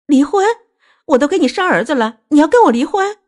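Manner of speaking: high, loud, outraged questioning; shocked disbelief. Acted. Wife who bore a son facing divorce, incredulous. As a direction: throw the word back in disbelief, pitch soaring.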